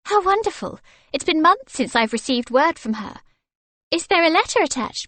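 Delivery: high, bright delight; thrilled at the news. A narrator's reading.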